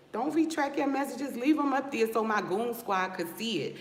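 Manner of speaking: high-pitched taunt at a heckler. Real, not acted. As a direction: call out the commenter, pitched high. Taunting a commenter who deletes messages.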